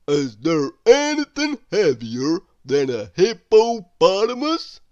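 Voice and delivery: loud, odd dopey character voice; Mister Dizzy voice. A narrator's reading. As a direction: boom it out as a silly fellow. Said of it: "asked Mister Dizzy".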